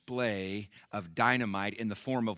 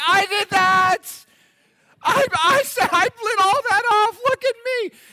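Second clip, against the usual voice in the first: much higher, much louder bragging cry; boastful pyro guy; 14 dB louder than usual. Retold or imitated. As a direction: shout for attention like a show-off. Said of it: "he's like".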